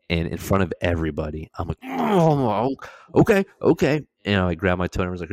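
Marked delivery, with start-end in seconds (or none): 1.8-4.0 high, strained okay okay; pinched voice swallowing the pain; strained coping. Retold or imitated. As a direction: hold it together through the pain. Toe dislocated in front of class.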